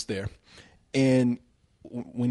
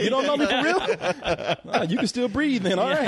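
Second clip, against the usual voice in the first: higher, laughing mock confession to the wife; laughing, hammed-up husband; 6 semitones higher than usual. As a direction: tease her through giggles.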